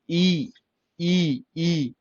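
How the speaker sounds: ई held long; long vowel. Shown as a demonstration. Teacher demonstrating long ई, drawn-out vowel. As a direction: stretch each ई as a separate sound. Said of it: "ये long ई है".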